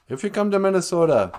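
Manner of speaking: normal talking voice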